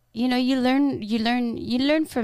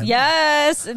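ordinary talk

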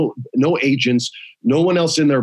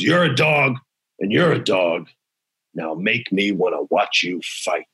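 commanding boss; 7 semitones lower than usual. Retold or imitated. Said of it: "He goes".

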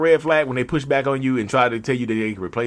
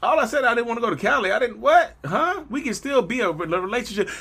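baffled; 13 semitones higher than usual. Acted. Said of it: "You're like".

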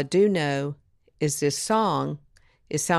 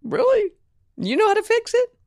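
much higher, incredulous half-snicker; amused disbelief; 4 dB louder than usual. Retold or imitated. Creative head doubting the young songwriter, amused disbelief. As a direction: tease her like she can't possibly know. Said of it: "kind of snickered a little bit".

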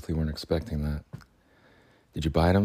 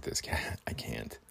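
whispered; 6 semitones lower, 11 dB quieter, slower than usual. Real, not acted. Insisting he can't hate the cat.

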